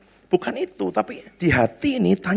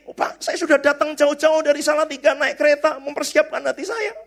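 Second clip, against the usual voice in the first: higher, pleading voice of a disappointed visitor; a hammed-up pleading protest; mock whiny complaint; 8 semitones higher than usual. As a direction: whine like someone who came far for nothing.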